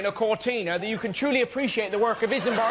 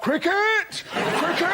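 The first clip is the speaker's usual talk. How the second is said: Telegraph calling out; 7 semitones higher, much slower than usual. Acted. Telegraph butting in to tell them, high cry. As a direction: hoot the word out like an announcement. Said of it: "Tell 'em, Telegraph".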